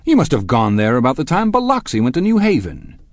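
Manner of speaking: high, loud mocking disbelief; incredulous and insulting. A narrator's reading. Husband challenges a rival's Oxford story, incredulous and insulting. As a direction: sneer it with rising incredulity. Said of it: "incredulous and insulting".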